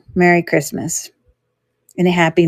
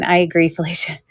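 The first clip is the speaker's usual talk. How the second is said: ordinary delivery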